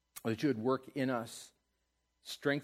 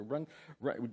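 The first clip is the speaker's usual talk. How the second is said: ordinary delivery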